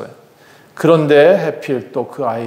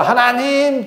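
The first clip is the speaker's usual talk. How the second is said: high, drawn-out plea; crying out to God; 8 semitones higher, much slower than usual. Acted. Leah keeps crying out to God, high imploring call. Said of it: "자꾸 불러질렀겠죠".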